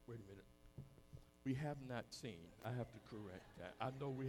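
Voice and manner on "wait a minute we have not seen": ordinary talk, nothing marked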